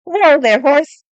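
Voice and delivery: loud, high command; shout at a horse. A narrator's reading. Severin grabs the rein of the knight's horse, loud shout. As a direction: yank the horse to a stop with your voice.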